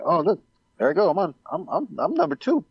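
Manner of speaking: like spotting your own name in lights; pleasantly surprised. Retold or imitated. Business owner seeing his Google ranking, excited surprise. Finding himself ranked on Google.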